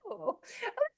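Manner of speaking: high, soft cooing "oh"; charmed listeners. Retold or imitated. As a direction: mimic people swooning over an accent. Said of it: "People are just like".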